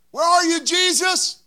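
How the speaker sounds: disciple asking. Acted. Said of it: "Go to the next verse".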